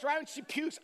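usual speaking voice

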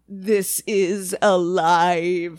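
drawn-out mock dread; slow eerie quote. Picturing the squid still alive, slow and spooky. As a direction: let each word hang, very slowly.

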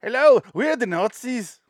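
high, loud, slow fake German voice; wrong German accent. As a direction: greet in a silly botched German accent. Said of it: "Just do wrong accents for everything".

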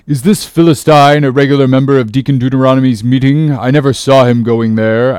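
put-on loud, accusing old captain; suspicious cry. A narrator's reading. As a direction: question it loudly, full of doubt. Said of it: "cried Bildad".

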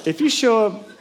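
hammed-up appalled reaction; shocked. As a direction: recoil in horror. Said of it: "I thought".

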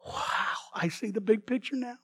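whispered, soft wow; sudden insight, whispered softly; awed realization. Retold or imitated. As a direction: breathe it out in wonder. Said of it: "all of a sudden you go".

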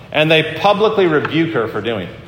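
normal talking voice

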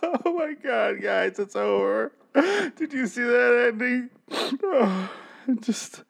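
high, fake-teary wail over the finale; mock sobbing. Host opening his review pretending to grieve the finale, mock sobbing. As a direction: ham up the heartbreak. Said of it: "I meant that opening".

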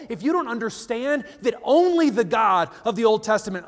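usual speaking voice

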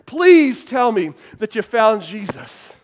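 loud, slow, drawn-out pleading; frustrated preacher. Acted. Preacher begging a dunked man for an answer, loud and frustrated. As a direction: plead with exasperation, stretching the words. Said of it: "he's frustrated".

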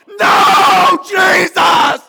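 desperate high shout of a lost soul; anguished cry. Acted. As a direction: cry out in terror at the last moment. Lost souls crying out at judgment.

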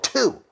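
TWO high and set apart; emphatic demonstration. Retold or imitated. Explaining English numbers to the server, emphatic TWO. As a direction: land hard on a lone "Two". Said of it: "this is what two sounds like".

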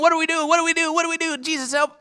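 high, frantic repeated pleas; freaking out. Acted. Disciples crying to Jesus in the storm, frantic panic. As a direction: panic like sailors in a sinking boat. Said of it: "they're, they're freaking out".

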